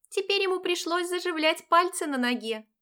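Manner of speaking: put-on high girlish friend voice; chirpy young friend; cartoon princess. A narrator's reading. (высокий девичий голосок)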